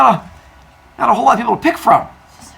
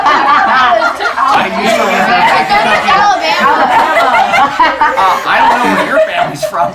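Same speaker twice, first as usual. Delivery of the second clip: laughing; 6 semitones higher, 6 dB louder than usual. Real, not acted. Laughing at student's joke.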